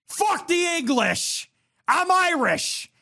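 high, slow, mock-proud declaration; hammed-up proclamation. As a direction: proclaim it like a stage patriot, drawn out. Hammed-up Irish pride declaration.

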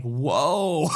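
a low, put-on startled whoa; mock surprise. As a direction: play it stunned and taken aback. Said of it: "And I'm like".

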